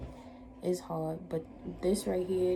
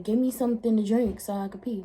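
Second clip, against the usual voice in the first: mock demand; 3 semitones higher, 7 dB louder than usual. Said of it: "So I'm just like".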